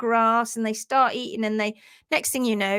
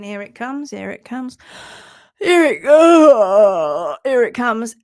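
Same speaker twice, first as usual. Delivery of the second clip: anxious anticipation; 10 dB louder, slower than usual. Retold or imitated. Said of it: "she stood there going".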